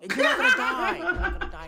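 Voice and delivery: high, loud laughing "not gonna die"; laughing reassurance. Real, not acted. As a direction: laugh while reassuring her. Laughing reassurance to scared girl.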